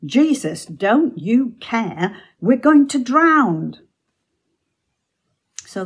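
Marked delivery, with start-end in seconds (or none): 0.0-3.8 loud, desperate, high cry for help; frightened disciples shouting at Jesus to wake; panicked shout. Retold or imitated. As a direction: yell over the storm in terror. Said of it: "So they shouted to him".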